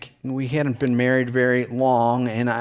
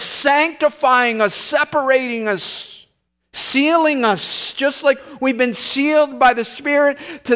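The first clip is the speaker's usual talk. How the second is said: higher, louder, building sermon voice; preaching at full force; 9 semitones higher, 4 dB louder than usual. Acted. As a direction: preach it with rising fervor.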